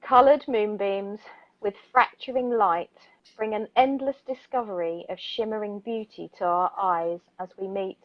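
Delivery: soft, even poetry reading; poem reading. Acted. Radio host begins reading a nature poem, gentle poetry voice. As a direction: let the images float gently. Reads poem Beams of Joy.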